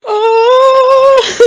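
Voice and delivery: high, loud anguished sob; wailing oh into sobs; sobbing cry. Acted. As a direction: let the grief burst out. Mother breaks down apologising.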